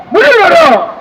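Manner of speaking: shouted high rally cry; roaring rallying speech. Real, not acted. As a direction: bellow the demand to the crowd. Rally chant against government.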